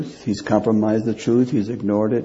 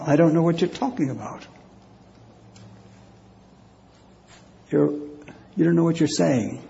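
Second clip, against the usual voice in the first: fast, hurried denial; rushed denial; 5 semitones higher, faster than usual. Acted. Peter's third denial, rushed and defensive. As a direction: brush them off quickly.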